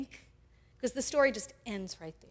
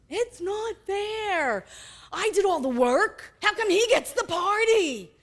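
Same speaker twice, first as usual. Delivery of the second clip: slow, higher, drawn-out whine; older brother sulking, self-righteous and slow; 7 semitones higher, 5 dB louder than usual. Acted. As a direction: whine it like a wronged child, slowly. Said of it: "filled with a sense of self-righteousness".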